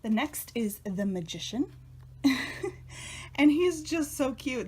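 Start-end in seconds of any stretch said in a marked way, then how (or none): 1.8-3.3 breathy little laugh. Real, not acted. Giggles over cute card. 3.4-4.7 high, loud cooing over cuteness; gushing. Real, not acted. Gushing over cute card.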